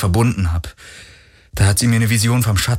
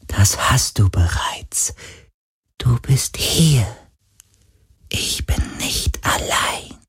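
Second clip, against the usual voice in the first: tender ghost whisper; 4 semitones higher, slower than usual. Acted. Ghost reassures Galen he is not alone, slow whisper. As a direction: reassure a friend gently.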